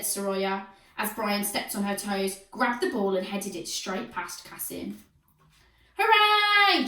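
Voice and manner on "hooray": high, loud cheer; high, loud triumphant cheer. A narrator's reading. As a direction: cheer the goal. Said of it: "cheered Al's team".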